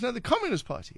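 high, lively quoted reply; imitated neighbour's answer. Host acting out the lady naming her party, high and lively.

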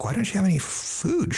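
high, incredulous ask; baffled question. Retold or imitated. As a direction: raise it up in disbelief. Baffled by fridge of alcohol.

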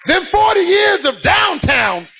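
high, driving sermon delivery; impassioned preacher in full cry; full-force preaching. Real, not acted. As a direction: preach it hard, punching out each phrase.

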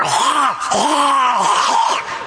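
imitating a Pharisee choking on a gnat; mock gnat-coughing hack. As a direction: cough out a swallowed gnat, half laughing. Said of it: "trying to cough the gnat up".

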